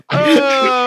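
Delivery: high helpless laughing fit; crying with laughter. Real, not acted. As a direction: laugh till you cry.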